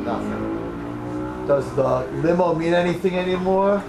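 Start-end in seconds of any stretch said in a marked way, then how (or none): 0.0-1.5 much softer, hollow; hushed and bleak. Acted. Preacher answers his own question to the crowd, hushed and bleak. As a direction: let it drop to almost nothing.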